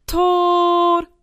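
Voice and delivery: very long, high, loud yell; exultant child's shout; jubilant goal cry. A narrator's reading. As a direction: stretch it out like a scoring striker. Boy cheering his goal.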